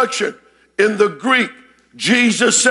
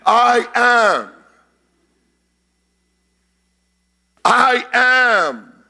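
ringing, loud proclamation; savored, resounding declaration; 3 semitones higher, 5 dB louder, much slower than usual. Acted. Preacher repeats I am for its sound, resounding declaration. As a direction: declare it weightily, relishing the sound. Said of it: "I like the way it sounds".

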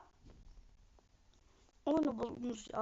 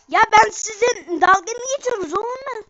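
loud, higher irritated scolding; angry scolding; 7 semitones higher, 6 dB louder than usual. Real, not acted. Gamer scolding players who mock him, angry. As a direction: tell them off sharply.

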